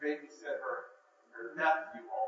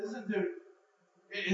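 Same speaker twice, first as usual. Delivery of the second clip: ordinary talk, nothing marked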